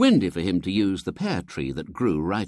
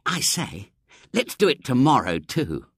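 put-on a touch higher, keen boy; lightly voiced Henry; 6 semitones higher, 4 dB louder than usual. Henry proposing a second feast as they leave, keen boy. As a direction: ask for another feast, eagerly.

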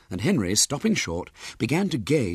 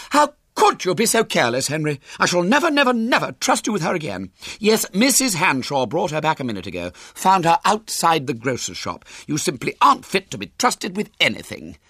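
high, loud, indignant mother; angry scolding; 8 semitones higher, 4 dB louder than usual. A narrator's reading. Mother to Henry after he lost the baby, indignant scolding. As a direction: scold emphatically, starting very high and raised.

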